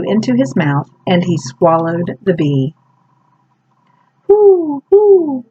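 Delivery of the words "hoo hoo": high, loud owl hooting. Acted. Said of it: "said a big round owl".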